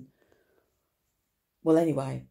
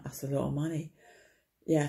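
ordinary delivery

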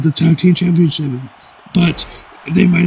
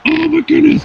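a sudden high cry of surprise; amazed exclamation; 11 semitones higher than usual. Acted. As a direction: jump up in pitch, shocked. Commentator reacts to swanton bomb.

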